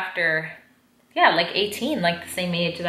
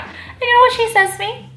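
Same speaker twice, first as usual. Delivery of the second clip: incredulous; 5 dB louder than usual. Real, not acted. Building to a surprise reveal.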